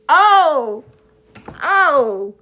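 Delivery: exclamation of dawning realization; loud realizing oh. Real, not acted. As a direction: catch on to something all at once. Reacting to the attackers' motive.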